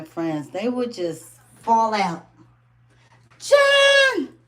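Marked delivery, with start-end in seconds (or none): 3.4-4.3 high joyful shriek of a name; excited scream. Retold or imitated. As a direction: scream the name like friends spotting you. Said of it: "They would scream like that".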